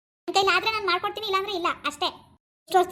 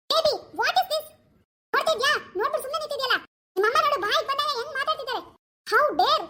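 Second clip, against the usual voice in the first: put-on a higher, nagging young wife; daughter-in-law role; 8 semitones higher than usual. Actor voices daughter-in-law scolding husband about mother. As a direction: complain sharply to husband about his mother.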